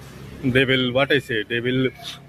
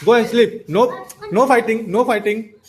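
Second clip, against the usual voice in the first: stern scolding; 6 semitones higher, 4 dB louder than usual. Real, not acted. Stopping nephews' toy-gun fight.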